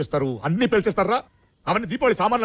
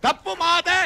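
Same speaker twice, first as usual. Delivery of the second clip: high, raised-voice concession; loud, clear admission; 8 semitones higher, 4 dB louder, much slower than usual. Acted. Captive told to say it properly admits fault, loudly. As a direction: shout it so nobody can miss it. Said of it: "సరిగ్గా చెప్పు".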